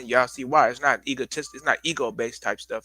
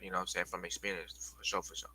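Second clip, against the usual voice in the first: whispered, trailing aside; 4 semitones lower, 12 dB quieter than usual. Real, not acted. Aside murmured to viewers.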